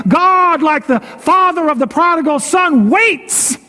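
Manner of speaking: a pastor crying hope to his flock; preaching at full force. Acted. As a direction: build the sermon to a fervent peak. Sermon climax, pitch rising.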